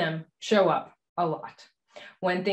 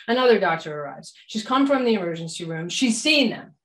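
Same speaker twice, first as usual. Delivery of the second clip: usual speaking voice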